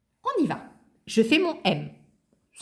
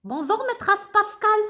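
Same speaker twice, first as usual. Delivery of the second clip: high, bouncy little puppet voice; high childlike puppet greeting the class; 11 semitones higher, 3 dB louder than usual. Acted. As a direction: play the cheeky puppet pupil.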